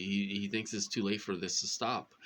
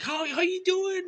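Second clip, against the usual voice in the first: very high, bright imitation of the clerk; friendly clerk voice; 5 dB louder than usual. Imitating the friendly store clerk greeting her. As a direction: chirp it like a friendly cashier. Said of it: "She's like".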